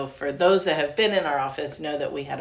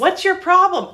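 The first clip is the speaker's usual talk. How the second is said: angry yell; 14 semitones higher, 9 dB louder than usual. Retold or imitated. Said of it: "And you were like".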